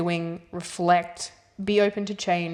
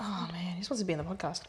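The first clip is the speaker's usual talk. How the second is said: whispering; 7 dB quieter, faster than usual. Real, not acted. Joking aside about a fly.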